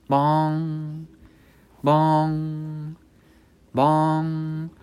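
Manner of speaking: high held bong like a clock bell; clock chime. Acted. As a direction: ring it out like a tolling clock. Clock strikes midnight.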